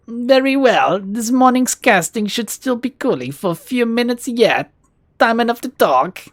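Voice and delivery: put-on Russian-accented shopkeeper introducing his drone; cultured Russian accent. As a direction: introduce your drone proudly, Russian-style. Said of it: "There's a hint of a Russian accent".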